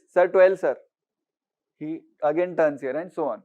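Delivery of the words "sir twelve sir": calling out a price. Acted. Seller undercutting, calling out.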